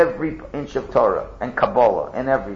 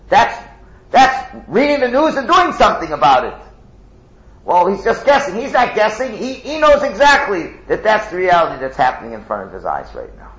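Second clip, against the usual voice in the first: high, loud fervent preaching; preaching at full force; 8 semitones higher, 7 dB louder than usual. Real, not acted.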